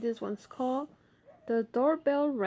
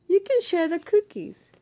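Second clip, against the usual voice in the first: Ma's voice; 9 semitones higher, 9 dB louder than usual. Acted. Ma voiced in story.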